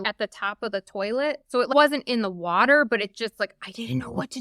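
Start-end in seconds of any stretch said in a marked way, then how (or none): none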